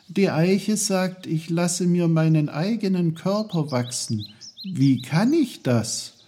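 put-on high-pitched, perplexed child; puzzled boy Peter. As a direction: report it in a high, baffled boy's voice. Peter's voice in story dialogue.

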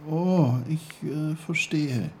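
drawn-out, unsure "oh, ich, äh"; doubtful. A narrator's reading. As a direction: pretend to follow, with audible doubt. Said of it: "sagte Peter zweifelnd".

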